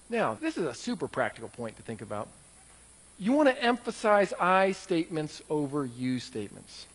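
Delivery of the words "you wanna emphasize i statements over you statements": usual speaking voice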